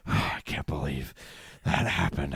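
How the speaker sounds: low, quiet, defeated mutter; deflated sigh. Retold or imitated. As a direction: let the air out of it. Sigh then deflated quote.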